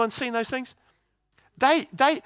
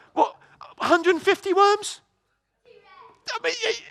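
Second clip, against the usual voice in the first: high, squeaky incredulity; mock disbelief; 12 semitones higher than usual. Preacher raising the worm count before the congregation, mock incredulous. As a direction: let the voice leap up, baffled.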